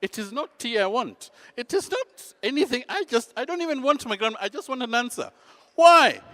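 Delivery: high-pitched, exasperated insistence; acted agitation boiling into a shout; agitated protest. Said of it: "I am agitated".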